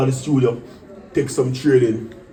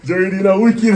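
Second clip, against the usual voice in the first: laughing hype; 5 semitones higher, 5 dB louder than usual. Retold or imitated. Repeating the hype call.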